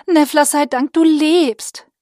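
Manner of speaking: relieved, glad she is alive. Acted. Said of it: "Minella wirkte erleichtert".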